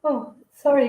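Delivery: talking through a yawn. Real, not acted. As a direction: yawn while saying it. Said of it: "I'm yawning".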